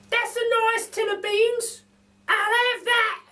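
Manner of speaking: very high, slow, greedy squeaky voice; a hidden voice drooling over the food; mystery voice. A narrator's reading. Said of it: "The voice says".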